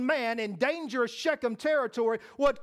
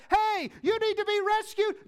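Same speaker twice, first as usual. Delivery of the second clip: high-pitched hail from a helicopter; acted-out rescuer's shout; calling out; 9 semitones higher, 3 dB louder than usual. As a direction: call down to someone stranded.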